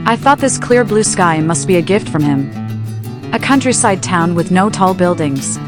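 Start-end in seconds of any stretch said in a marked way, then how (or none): none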